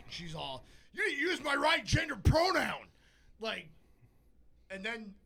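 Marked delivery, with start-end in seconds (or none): 0.9-2.9 high, drawn-out mock outraged shout; screaming. Woman scolding the man helping her across the street, screaming. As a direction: yell it as an offended pedestrian. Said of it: "Why are you screaming?".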